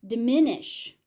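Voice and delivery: "Diminish" with short "di" and loud MIN; second-syllable stress. Shown as a demonstration. Teacher showing the stress, loud second syllable. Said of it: "Short first syllable".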